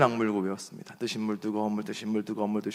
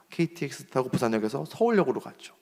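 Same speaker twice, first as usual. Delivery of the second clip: ordinary delivery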